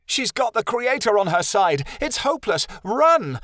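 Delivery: high, frantic shouting; panicked shout. A narrator's reading. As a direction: yell for everyone to flee. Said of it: "a unicorn shouted".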